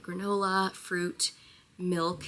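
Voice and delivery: normal talking voice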